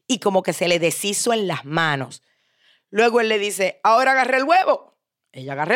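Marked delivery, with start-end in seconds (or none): 3.8-5.0 father instructing. Acted. Father gives order in story.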